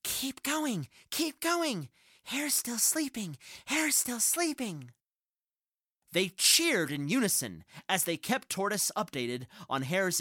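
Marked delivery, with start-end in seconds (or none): 0.0-4.9 high excited cheer. Acted. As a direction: cheer him on from overhead. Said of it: "cheering and exclaiming".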